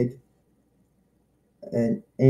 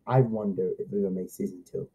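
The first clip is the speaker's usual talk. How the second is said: much lower, softer and fast; quick quiet aside; 7 semitones lower, 8 dB quieter, faster than usual. Shown as a demonstration. Kid wondering about a second season, quick quiet aside. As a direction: mutter it to yourself, hurried.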